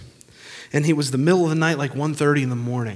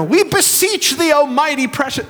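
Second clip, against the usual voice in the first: mock beseeching; 12 semitones higher, 6 dB louder than usual. Preacher parodies archaic prayer to God, mock beseeching. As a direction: overdo the pious pleading.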